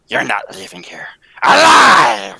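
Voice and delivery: menacing yell of a death threat; threatening shout. Acted. Villain shouting a death threat at Miss Trueheart.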